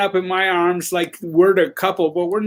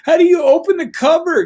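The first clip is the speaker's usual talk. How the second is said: a much higher, louder puzzled question; clueless kid; 9 semitones higher, 5 dB louder than usual. Retold or imitated. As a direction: ask it like a lost, helpless kid. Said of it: "he goes".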